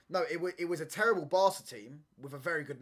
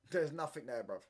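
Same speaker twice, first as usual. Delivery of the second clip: ordinary delivery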